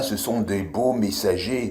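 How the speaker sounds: usual speaking voice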